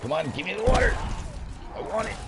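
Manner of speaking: high-pitched, urgent plea; impatient demand. Real, not acted. Urging the game mid-play.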